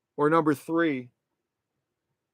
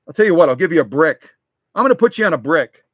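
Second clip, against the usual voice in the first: quick, louder announcement; brisk, louder; 8 dB louder, faster than usual. Real, not acted. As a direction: cheerfully tell them fast. Talking to toy figures.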